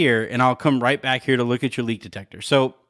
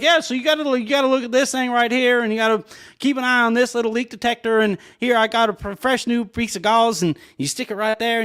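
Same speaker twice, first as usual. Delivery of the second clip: higher-pitched Texan workman rambling on; Texan accent; 10 semitones higher than usual. Retold or imitated. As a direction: do an oblivious Texan repairman. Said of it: "you got a decent Texan accent now".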